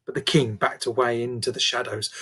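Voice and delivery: ordinary delivery, nothing marked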